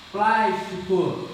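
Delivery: Plástico said slowly and loud, first syllable Plás- hit hard; slow stressed demo. Shown as a demonstration. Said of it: "antepenúltima sílaba é tônica". (Plástico lento e forte, ênfase em Plás)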